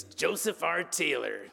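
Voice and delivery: cartoonish chief master sergeant impersonation; puffed-up sergeant announcing his own name; Joseph R. Taylor. Preacher introduces his cartoon-like bandmate, self-important impersonation. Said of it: "That's how he talked".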